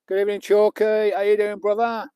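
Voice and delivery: big friendly shout-out; cheery greeting. Real, not acted. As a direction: greet a mate warmly across the room.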